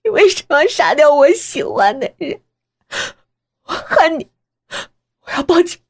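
high, distraught accusation; hysterical crying out. Acted. Daughter tells mother she hates her, hysterically crying out. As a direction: cry out in anguish and hate. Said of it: "我歇斯底里地叫着".

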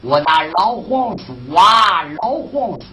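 usual speaking voice

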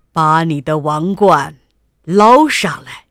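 king's loud, stern order; commanding. A narrator's reading. King ordering his daughter to fetch the crown, commanding. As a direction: give a royal command, leaning loudly on the verb. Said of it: "国王命令道".

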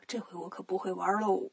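hushed, worried whisper. Acted. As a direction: whisper the doubt under your breath. Tang Seng frets in secret.

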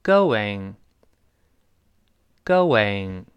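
"going" with a w glide, go-wing; linking glide. Shown as a demonstration. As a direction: say "going" slowly as go-wing, twice. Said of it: "加一个半元音喔".

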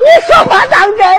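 high, fast, disbelieving cry; startled joy. Acted. Blind old father to the village headman, startled excitement. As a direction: leap up in astonished delight. Old man hears son became scholar.

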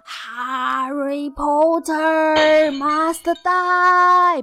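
slow, drawn-out raspy villain chant; hoarse Voldemort shout. Retold or imitated. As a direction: croak it like a moviegoer aping Voldemort. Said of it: "扯着嘶哑的嗓子喊".

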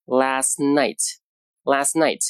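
"last night" with the t of "last" unreleased, very slow; slow teacher demo of "last night"; last night, t dropped. Shown as a demonstration. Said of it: "读成".

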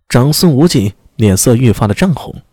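ordinary delivery, nothing marked

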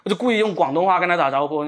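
ordinary delivery

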